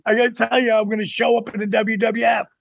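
high, fast retold excitement; excited announcement. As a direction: blurt the big plan out, high-pitched. Excitedly telling wife his plan, retold.

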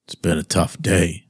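flat, low monotone quote; deadpan, no life in it. Retold or imitated. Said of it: "in a monotone voice".

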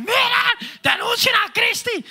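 very high-pitched pretend cry of accusation; mock outcry. Viewers reporting Cristi from the recording, mock outcry. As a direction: call it out in a squeaky, hammed-up alarm.

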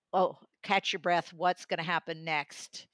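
ordinary talk

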